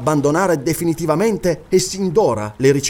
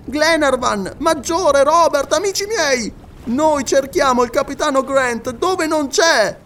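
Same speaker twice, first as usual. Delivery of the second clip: much higher, slow, urgent announcement; high excited scholar declaring an error; Paganel's revelation; 9 semitones higher, slower than usual. Acted. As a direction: stress each mistake as a discovery.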